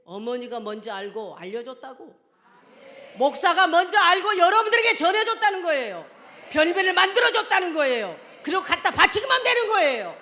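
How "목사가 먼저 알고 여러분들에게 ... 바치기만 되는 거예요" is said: preaching shout. Real, not acted. Preaching at full force.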